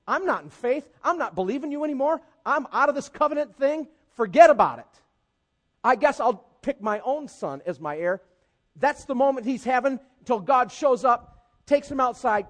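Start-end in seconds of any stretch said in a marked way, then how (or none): 0.1-8.2 higher, louder, sulky quitting; fed-up Abram. Retold or imitated. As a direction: throw up your hands at God. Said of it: "He was going from".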